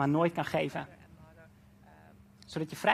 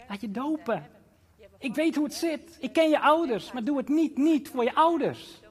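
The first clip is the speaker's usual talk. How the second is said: rising to a loud, high fatherly plea; God the Father speaking; 10 semitones higher, 4 dB louder than usual. Acted. Preacher voicing God the Father to the congregation, loud pleading. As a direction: call it out as God pleading with his child. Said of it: "En je Vader in de hemel zegt".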